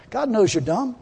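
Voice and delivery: normal talking voice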